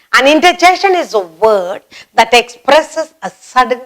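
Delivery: ordinary delivery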